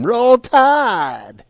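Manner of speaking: high, loud mock fan yell; mock scream. Warning a guest not to scream in the parking lot, mock scream. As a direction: holler it long and high like a rowdy fan. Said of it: "and scream".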